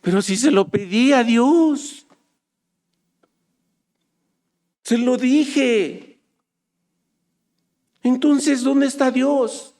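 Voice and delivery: high, drawn-out complaint to God; protesting doubter. Acted. Preacher voices a disappointed believer questioning God, in high protest. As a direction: wail it as a let-down believer. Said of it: "resuelve, dices".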